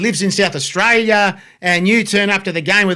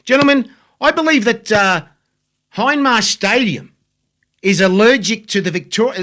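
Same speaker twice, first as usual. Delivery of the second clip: ordinary talk, nothing marked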